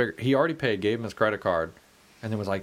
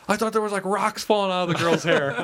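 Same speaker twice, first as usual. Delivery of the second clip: put-on dazed drunk; 8 semitones higher, 4 dB louder than usual. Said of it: "He's like".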